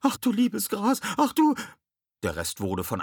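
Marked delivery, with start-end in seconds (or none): 0.0-1.6 very high, loud squeak; high-pitched cry of alarm; panicked squeal. A narrator's reading. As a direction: squeal it as you tumble overboard. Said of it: "quiekte Jasmine".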